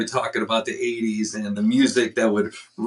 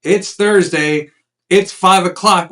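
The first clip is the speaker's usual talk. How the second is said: loud, high announcer welcome; big announcer voice welcoming viewers; show host intro; 7 semitones higher, 7 dB louder than usual. Acted. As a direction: open the show like a host hyping the crowd.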